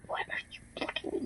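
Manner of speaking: voiceless whispered disbelief; retold whisper. Student reacting to a classmate's stare, whispered. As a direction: whisper it, barely there.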